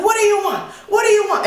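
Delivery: high-pitched imitation of a livid man; exasperated mock outburst. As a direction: demand it like a coworker losing his temper. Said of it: "he is getting livid".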